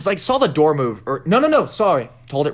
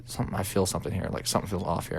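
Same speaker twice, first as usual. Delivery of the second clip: much softer, lower and hurried; retold quiet unease; 4 semitones lower, 9 dB quieter, faster than usual. Girlfriend sensing something in the house, quiet and uneasy. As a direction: keep it hushed and low. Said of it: "she kinda got quiet".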